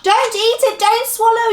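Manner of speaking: high, loud panicked yell; urgent, alarmed shriek of warning; frantic yell. Acted. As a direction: shout to stop someone at once. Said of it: "yelled Moonface".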